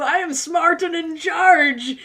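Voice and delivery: slow, loud, pompous mock declaration; mock proclamation. Viewer told to warn their person, slow loud mock boast. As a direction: proclaim it with overblown dignity. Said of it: "shake your fist at them and say".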